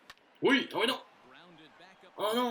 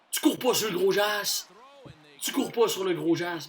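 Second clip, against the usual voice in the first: louder, mocking call; taunting shout; 4 semitones higher, 7 dB louder than usual. Real, not acted. Gamer jeering at the runner he threw out, loud and mocking. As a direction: rub it in with a loud jeer.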